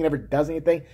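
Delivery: ordinary talk, nothing marked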